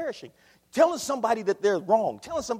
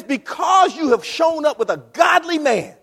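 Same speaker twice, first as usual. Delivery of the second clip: prophet's rebuke; 4 semitones higher, 10 dB louder than usual. Acted. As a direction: bark the reason at the king. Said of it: "But he says".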